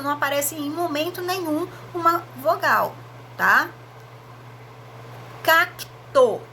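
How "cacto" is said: "Cacto" with both C and T sounded crisply; clear sounding out. Shown as a demonstration. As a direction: say "Cacto" letting the C and T both ring. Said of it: "eu pronuncio C e pronuncio T". ("Cacto" com C e T bem pronunciados)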